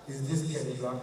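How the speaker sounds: a lightly deep rich big man. Pretend. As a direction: sound like money talking. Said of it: "When they call me, hear voice".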